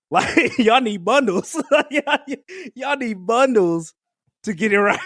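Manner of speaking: mocking the line while giggling; laughing tease. Real, not acted.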